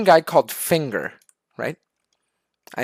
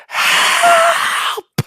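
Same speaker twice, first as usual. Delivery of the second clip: very high, drawn-out loud scream; screaming for help; 5 dB louder than usual. Acted. Inmate slamming on the cell door, screaming for help. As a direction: shriek it long from behind a door.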